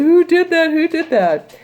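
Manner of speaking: high, loud mock panic; panicked Macbeth. Teacher plays Macbeth seeing the ghost, high and panicked. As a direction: imitate Macbeth freaking out at the ghost. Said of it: "He's like".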